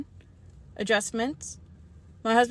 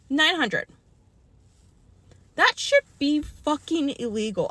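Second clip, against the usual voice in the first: ordinary talk, nothing marked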